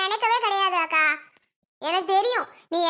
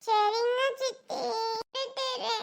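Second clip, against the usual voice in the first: put-on slow, softening child whimper; tearful child; 2 semitones higher, 7 dB quieter, much slower than usual. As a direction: give in tearfully, dragging the words. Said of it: "இதுக்கு போய் இப்படி அழுகுற?". (அழுதபடி பணியும் குழந்தைக் குரல்)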